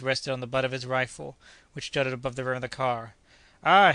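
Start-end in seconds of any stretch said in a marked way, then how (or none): 3.6-3.9 loud desperate "Ah!" scream; mad scream. A narrator's reading. Maddened Kennedy sees his rifle, desperate scream. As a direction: scream as he rises. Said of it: "he screamed".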